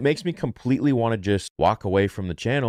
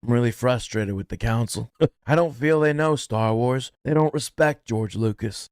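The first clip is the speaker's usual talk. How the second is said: a hint of Anakin, whiny and higher; Anakin impression; 5 semitones higher than usual. Fan mocking the council as Anakin, lightly voiced. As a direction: channel a sulky young Anakin. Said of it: "Goated impersonation".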